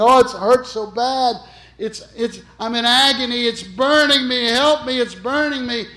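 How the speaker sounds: high, loud pleading cries of pain; agonized screaming. Retold or imitated. Dying woman cries out from her bed in pain. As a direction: wail it like burning alive. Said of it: "she's screaming".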